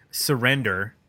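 normal talking voice

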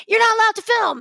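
mock bossy protester; imitating protester. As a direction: parrot the protester's rule. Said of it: "having that said to me in Portland".

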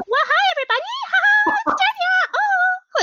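mock her own recorded voice as a squeaky kid; squeaky high voice. Showing how her recording really sounds, squeaky and high. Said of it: "This is how it translates".

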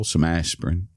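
ordinary delivery

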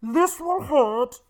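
high, drawn-out masked villain voice; slow, hammed-up villain impression; Bane. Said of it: "'cause the Bane says".

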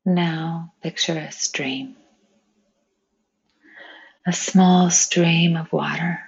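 unhurried meditation voice with long pauses; guided meditation. Acted. Meditation leader inviting friends to picture flowing water, calm and spacious. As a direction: guide them gently, phrase by phrase, leaving space.

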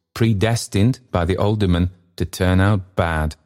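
ordinary delivery, nothing marked

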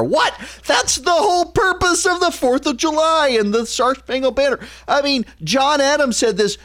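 high-pitched, exasperated outburst; incredulous outrage. Real, not acted. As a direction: protest in high, disbelieving indignation. Outraged reaction to proposed fireworks ban.

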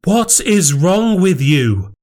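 high, slow, exasperated demand; storming angry. A narrator's reading. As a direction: round on your husband in outrage. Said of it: "she came storming back".

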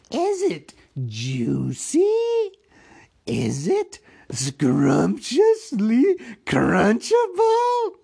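high, slow, gloating Gollum; hissing with pleasure. Acted. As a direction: savour each word in a drawn-out creature hiss. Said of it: "began to hiss with pleasure".

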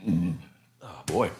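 burping through the words; burpy. Real, not acted. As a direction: talk through a small burp. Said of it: "Excuse me, I'm burpy".

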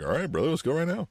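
lightly voiced wrestler hyped to go; Hulk Hogan. Imagined Hulk Hogan accepting the ride offer, a light take. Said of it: "just knowing Hulk Hogan, he'd be like".

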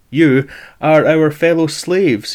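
sophist voice. Acted. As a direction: lecture like a smug sophist. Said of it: "he would say".

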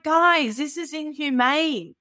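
high, indignant outburst, last words slow; retold protest. Protesting the caged bear.

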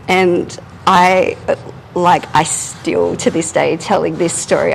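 ordinary delivery

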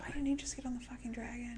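hushed, voiceless question; a friend's whispered jab during the show; whispered aside. Real, not acted. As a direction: whisper it under your breath.

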